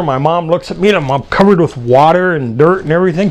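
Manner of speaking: usual speaking voice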